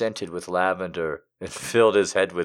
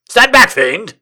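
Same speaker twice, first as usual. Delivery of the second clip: loud, high mock-heroic challenge; hammed-up heroic cry; 13 semitones higher, 11 dB louder than usual. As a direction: cry it out like a pulp hero. Mock heroic outburst mid-story.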